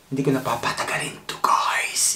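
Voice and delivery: quick hushed whisper to the viewers. Real, not acted. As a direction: drop to a breathless whisper. Vlogger whispers aside to viewers.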